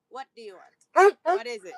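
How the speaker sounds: loud pet-talk question. Real, not acted. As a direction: call out to the dog. Talking to the dog.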